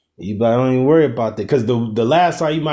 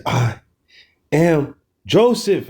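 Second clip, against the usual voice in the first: "am Joseph" slow and higher, overcome; emotional emphasis; 4 semitones higher, slower than usual. Shown as a demonstration. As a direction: let "I am Joseph" swell with feeling, slowly. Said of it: "shaking and stuff when you really, like, emotional".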